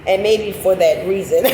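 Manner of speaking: ordinary talk, nothing marked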